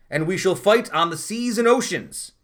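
slow, loud, stately declamation of the line; dramatic reading. Shown as a demonstration. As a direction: proclaim it to the nation, slowly. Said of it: "I'll give you a dramatic reading".

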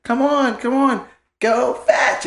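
high, drawn-out coaxing of a dog; imitated eager kid. Nephew urging the pit bull to play, imitated eager kid. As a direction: mimic a kid egging a dog on.

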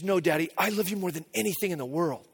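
softly imitating a little girl's devotion; quiet, earnest one-upping from a child; insistent loving daughter. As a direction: retell it low-key and softly. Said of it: "And she goes".

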